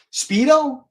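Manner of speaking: Speedo squeaked way up high; a teasing high-pitched Speedo; mock squeal. Mocking the idea of Speedo.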